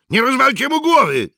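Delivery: calling out. Acted. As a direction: call across to the diggers. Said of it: "zawołał Mikke".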